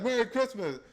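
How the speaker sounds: high, loud fake-cheerful Merry Christmas; mock greeting. The devil mocking Christians, fake-cheerful. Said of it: "He's like".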